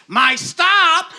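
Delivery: high panicked shout; old lady's cry. Retold or imitated. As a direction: yelp it like a frail old lady about to miss her stop. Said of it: "and she goes".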